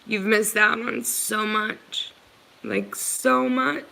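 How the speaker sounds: fighting back tears while speaking; real emotion, the voice climbs and strains; getting emotional. Real, not acted. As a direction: voice rising high as tears break through. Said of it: "Sorry, guys, for getting emotional".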